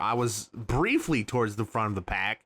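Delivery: ordinary talk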